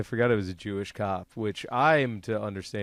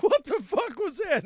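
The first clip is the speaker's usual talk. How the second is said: high, startled cry of alarm; startled waking up. Retold or imitated. Jolted awake by a movie bomb, high alarmed cry. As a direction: jolt awake yelling in shock. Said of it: "you're like".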